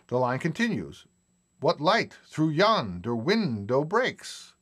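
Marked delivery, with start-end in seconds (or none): 1.6-4.5 slow, even beat through "What light through yonder window breaks?"; heartbeat pulse. Shown as a demonstration. Teacher demonstrating the meter, slow steady heartbeat stress. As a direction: tap out the iambic pulse, slowly. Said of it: "The pulse of the line is set up like the human heartbeat".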